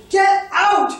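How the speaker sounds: high, loud burst of a yell; sudden full-force retold scream; yelled command. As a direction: yell it with all your energy, imitating a teacher. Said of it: "he would yell it".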